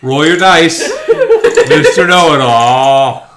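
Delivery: loud taunting call; mocking announcement. GM mocks player loudly.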